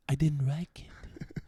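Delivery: slow, hushed whisper. Retold or imitated. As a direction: whisper it like a scared kid. Scared child memory.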